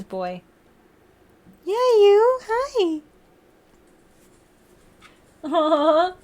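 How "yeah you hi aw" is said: high cooing greeting. Real, not acted. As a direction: squeak it to the pet. Cooing at pet.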